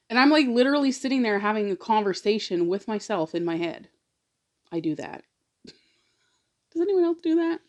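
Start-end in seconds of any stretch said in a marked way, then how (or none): none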